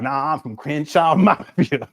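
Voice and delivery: slow, swaggering street-tough voice, laughing mid-line; mock tough claim. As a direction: lightly imitate a wannabe gangster bragging. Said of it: "y'all want to get up here, talk".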